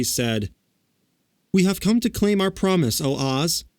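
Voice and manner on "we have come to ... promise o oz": put-on high, earnest girl; a girl pleading her case; young girl voice. A narrator's reading. Dorothy's voice.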